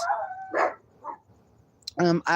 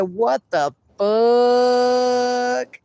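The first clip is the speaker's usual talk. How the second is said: drawn-out shocked reading; 3 semitones higher, much slower than usual. Acted. Reading a chat message aloud.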